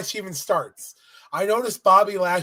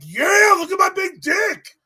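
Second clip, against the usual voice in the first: high, loud gloating yell; hammed-up boastful champion shout; 11 semitones higher, 5 dB louder than usual. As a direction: crow like a champ showing off. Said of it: "He's all like".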